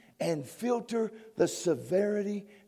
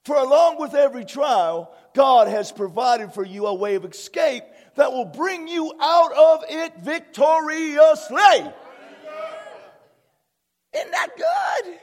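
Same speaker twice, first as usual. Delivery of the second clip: preaching at full force; 3 semitones higher, 8 dB louder than usual. Acted. Pastor preaching victory to his congregation, full force.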